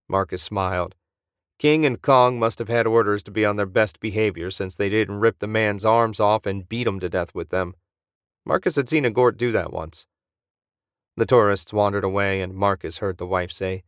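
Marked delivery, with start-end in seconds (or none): none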